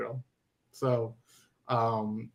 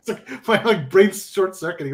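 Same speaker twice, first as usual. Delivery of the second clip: talking through laughter, pitched up; laughing; 5 semitones higher, 4 dB louder than usual. Real, not acted. Host cracking up at his brain short circuiting, laughing. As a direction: laugh while getting the words out.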